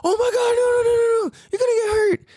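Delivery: panicked parent. Retold or imitated. Parent panicking at a kid about to get hurt, mock shriek. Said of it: "They go".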